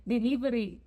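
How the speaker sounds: put-on "delivery" in a Spanish accent, with tapped r; "delivery" with Spanish vowels and a tapped r, not English. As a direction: say "delivery" as a Spanish word, light quick consonants. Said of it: "say it with the accent".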